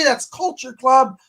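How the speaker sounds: ordinary talk, nothing marked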